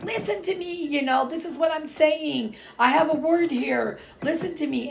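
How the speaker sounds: high-pitched, exasperated plea; insistent plea to be heard. Retold or imitated. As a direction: act out begging the family to listen. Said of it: "then I talk really loud".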